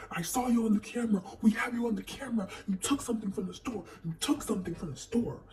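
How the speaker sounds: fast, insistent man's voice; accusing store man. Retold or imitated. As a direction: push the accusation hard. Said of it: "he's like".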